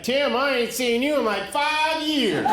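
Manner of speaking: hammed-up uncle greeting him with big surprise, stretched slowly; excited uncle. Uncle running up after years apart, high excited greeting. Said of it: "he's like".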